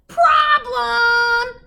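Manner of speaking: very high, loud screech of alarm; screeching witch. A narrator's reading. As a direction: shriek it like a panicked witch. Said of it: "screeched Gritch".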